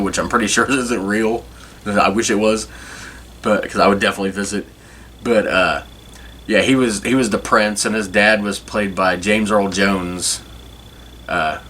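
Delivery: usual speaking voice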